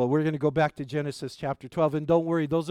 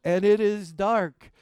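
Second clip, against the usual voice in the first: slow, louder "And it is dark"; slow dramatic line; 3 semitones higher, 4 dB louder, slower than usual. Retold or imitated. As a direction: lean on "And it is dark", slow and heavy. Repeat for dramatic effect.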